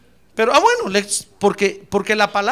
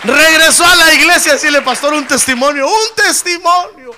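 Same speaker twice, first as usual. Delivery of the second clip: loud, high, jubilant cry of praise; full-force preaching; 9 semitones higher, 11 dB louder than usual. Real, not acted. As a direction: exult to the congregation at the top of your voice. Preacher shouts praise and testimony. (predicación exaltada, a gritos)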